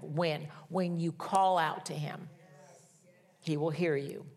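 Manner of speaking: usual speaking voice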